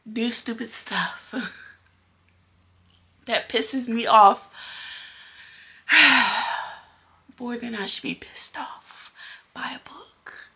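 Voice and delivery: hushed exasperated whisper; breathy frustrated aside. Real, not acted. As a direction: whisper the annoyance under your breath. Whispered rant about book.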